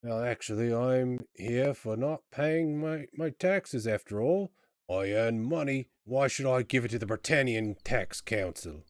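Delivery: aggrieved Cockney tax dodger; emphatic, grumbling Cockney complaint; Cockney prisoner. Acted. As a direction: protest like an indignant Cockney jailbird. Prisoner explains his tax offence.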